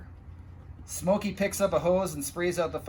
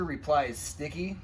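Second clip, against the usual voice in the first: plain reading voice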